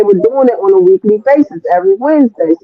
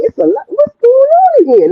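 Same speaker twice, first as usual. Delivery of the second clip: much higher bewildered question; amazed disbelief. Retold or imitated. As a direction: thrown by what's happening in the room. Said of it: "I was like".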